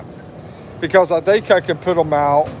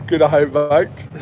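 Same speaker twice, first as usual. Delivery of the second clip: mock Aussie slip; slower than usual. Shown as a demonstration. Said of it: "you're silly, [name]".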